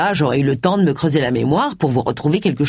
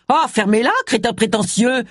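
yelled; 6 semitones higher than usual. A narrator's reading. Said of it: "vociféra Agatha".

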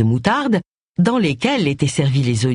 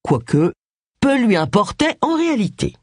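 plain reading voice

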